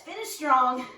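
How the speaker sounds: loud coach call to finish; shouted push. Real, not acted. Coach pushing final seconds.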